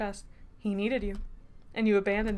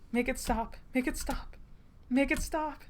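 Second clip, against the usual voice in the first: raised pitch, climbing with each plea; cracking voice; 4 semitones higher than usual. A narrator's reading. Character begging the taunting voice to stop, voice cracking. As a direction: plead with your voice close to cracking. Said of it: "My vocal cords crack with the words".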